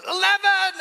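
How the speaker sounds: called out high and loud, slow; shouted number. Retold or imitated. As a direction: yell it across the canteen. Prisoner calls out a number.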